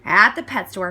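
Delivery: ordinary delivery, nothing marked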